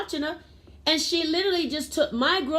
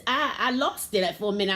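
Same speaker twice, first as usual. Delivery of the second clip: usual speaking voice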